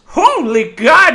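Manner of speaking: mock astonishment. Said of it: "you may have just said".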